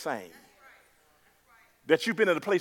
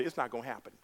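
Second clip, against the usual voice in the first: softer, deflated; doubting mutter; 5 semitones lower, 12 dB quieter, much faster than usual. Pretend. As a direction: let the doubt slip out quietly. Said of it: "you say".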